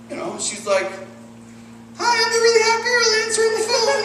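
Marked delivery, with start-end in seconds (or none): 2.0-4.0 high put-on girly voice; mock a female receptionist in a very high voice; high-pitched caricature of a girl on the phone; hot girl receptionist. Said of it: "there's a really hot girl that answers the phone".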